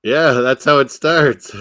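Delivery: put-on loud, drawn-out old commentator catchphrase; slow, booming catchphrase impression; Bob Cole. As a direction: call it slowly and loudly, imitating a hockey broadcaster. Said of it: "he always used to say it like that".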